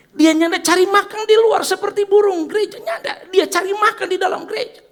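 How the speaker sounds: high, loud preacher's cry; full-force preaching. Acted. Pastor to congregation about the spider, loud high preaching. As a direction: lift the hall with the point.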